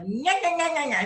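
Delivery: high, mildly loud nagging "nya-nya" imitation; mock screaming. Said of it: "like, screaming profanities".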